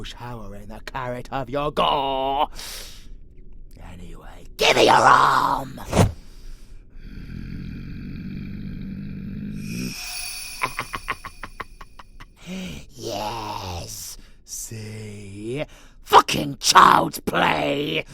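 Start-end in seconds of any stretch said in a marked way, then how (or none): 5.8-12.9 drawn-out cackle of cruel glee; long wicked laugh. Acted. Captor gloating while seizing Bloodbag's arm, long wicked laugh. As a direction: let a long evil laugh roll out. Villain grabs captive's arm.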